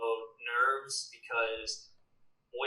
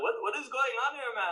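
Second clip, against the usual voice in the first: put-on high-pitched, hammy Indian accent; bad Indian accent; 10 semitones higher than usual. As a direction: overdo a clumsy Indian accent. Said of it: "That's a really bad Indian accent".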